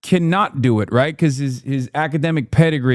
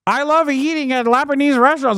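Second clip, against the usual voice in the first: mock twang; 10 semitones higher than usual. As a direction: parody the politician's folksy twang. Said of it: "puts the twang in there".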